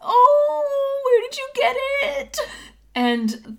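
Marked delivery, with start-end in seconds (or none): 0.0-2.4 very high, drawn-out adoring squeal; young gnome gushing. Acted. Young gnome seeing a cute pseudodragon, high gushing exclamation. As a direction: coo in a tiny high voice. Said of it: "softly exclaim at the sight of Nami".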